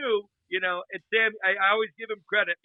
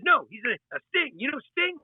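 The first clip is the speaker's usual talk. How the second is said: high excited Sammy; 6 semitones higher than usual. Retold or imitated. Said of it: "He said".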